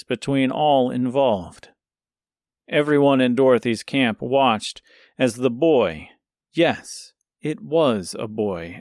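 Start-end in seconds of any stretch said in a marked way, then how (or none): none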